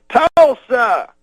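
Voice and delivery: high, loud crowd-baiting call; Bill Cosby impression. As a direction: holler it high from the stage. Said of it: "Then he goes".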